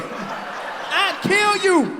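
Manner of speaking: slow, high, loud mock snarl while laughing; hammed-up strangler's growl through laughter; mock threat. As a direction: drag out the threat, half laughing. Choking a kid in a fight.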